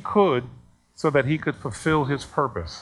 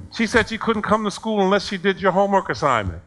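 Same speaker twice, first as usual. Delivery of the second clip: retold angry complaint; 6 semitones higher, faster than usual. Acted complaint of angry parent.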